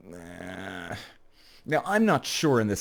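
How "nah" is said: low, barely voiced noncommittal noise; a very quiet, low, drawn-back nah; reluctant. Reluctant reply to invitation.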